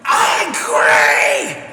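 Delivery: loud crying shout; mock angry yell. Viewer declaring anger at a video, crying shout. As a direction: scream the word out in fake fury. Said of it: "I am so".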